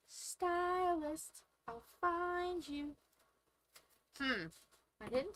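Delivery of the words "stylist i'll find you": sing-song calling in a high voice; playful villain taunt. Pretend. Calling to lost stylus, hide-and-seek.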